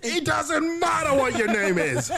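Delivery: high, loud roar of contempt; Crixus shouting. Acted. Crixus cutting off Spartacus in the barracks, shouted. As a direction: bellow over him, pitch shooting right up.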